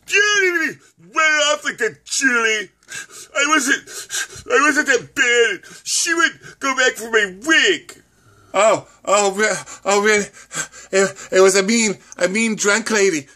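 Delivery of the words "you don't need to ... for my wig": high, tearful wail of a hurt woman; hammed-up sobbing complaint; crying high voice. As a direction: whimper it through tears, pitched way up. Tearful character quarrel.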